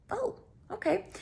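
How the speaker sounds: high, soft acted realization; suspicious reaction. Reacting to smell of cologne.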